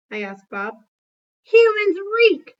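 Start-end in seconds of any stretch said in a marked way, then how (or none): 1.4-2.6 high, loud, slow dog voice; Bob's retort. Acted. Bob the dog scorning human smell, high, loud and slow. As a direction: drag it out in disgust. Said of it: "Bob replies".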